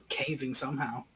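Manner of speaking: normal talking voice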